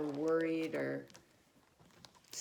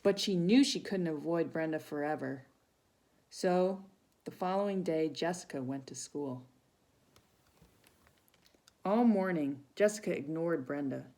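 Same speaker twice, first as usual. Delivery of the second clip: storybook reading; 4 semitones higher, 5 dB louder than usual. Acted. Reads picture book to children.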